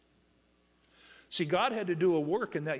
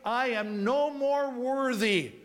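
higher, slow, pressing on worthy; forceful preaching; 7 semitones higher, 4 dB louder, much slower than usual. Acted. Preacher voicing the son's confession, loud and drawn out. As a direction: lean hard into the confession, slowly.

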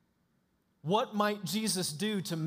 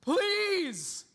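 "please" pushed much higher and louder, breaking; crying plea; 7 semitones higher, 5 dB louder, much slower than usual. Retold or imitated. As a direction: beg Jesus like a desperate father. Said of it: "He's crying".

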